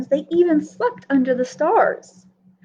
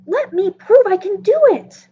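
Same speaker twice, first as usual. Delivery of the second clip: higher and louder, keen to prove himself; eager plea; 6 semitones higher, 4 dB louder than usual. Acted. Little Carr asks Dusty for a chance, eager plea. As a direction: beg for a chance with full energy.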